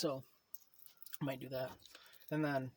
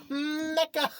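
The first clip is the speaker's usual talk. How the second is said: mocking name; 12 semitones higher, 5 dB louder than usual.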